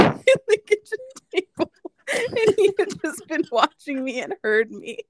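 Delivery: high, helpless laughing through the words; laughing while talking. Real, not acted. Telling of the roommate who overheard, laughing. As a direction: struggle to tell it through real laughter. Cracks up telling embarrassing story.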